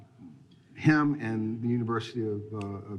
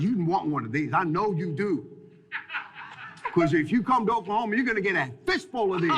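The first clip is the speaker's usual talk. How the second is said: coach's sales pitch; 7 semitones higher than usual. Retold or imitated. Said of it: "And he goes".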